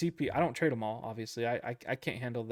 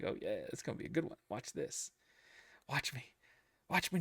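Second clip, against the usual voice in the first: cocky overconfidence; 7 dB quieter than usual. Retold or imitated. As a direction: mimic your own overconfident trading self, quiet then cocky. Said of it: "instead of being like".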